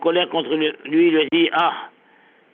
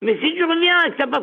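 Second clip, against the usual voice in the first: pitched well up throughout; raised scolding master; high preaching rebuke; 10 semitones higher than usual. Retold or imitated. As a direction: lecture him from up high. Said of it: "Abraham a dit à Eliezer".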